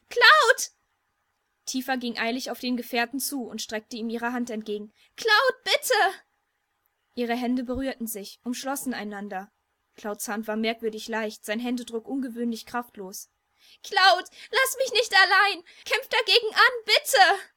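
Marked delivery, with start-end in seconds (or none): 0.1-0.7 high, loud, frantic cry; desperate shout. A narrator's reading. Tifa calls out as Cloud fades. 5.2-5.9 high, loud, pleading shout; desperate plea. A narrator's reading. Tifa calls out as Cloud fades. 13.8-17.5 high, loud, cracking plea; slow desperate cry that breaks; voice breaking. A narrator's reading. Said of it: "Tifas Stimme überschlug sich".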